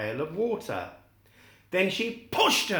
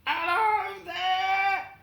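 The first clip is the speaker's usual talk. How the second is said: high, slow, drawn-out call; Scarecrow calling; slower than usual. Acted. The Scarecrow calling out to Dorothy, high and drawn out. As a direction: call out to a passing stranger. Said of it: "called the Scarecrow".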